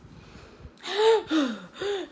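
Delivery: a big, high, theatrical gasp for air; dramatic gasp. Retold or imitated. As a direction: gasp as if you can't breathe, hammed-up. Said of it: "acting like she had a panic attack".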